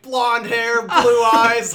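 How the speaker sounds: loud, high, laughing quote of her wish list; mock contestant. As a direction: play the oblivious girl listing features, laughing. Quoting contestant's description.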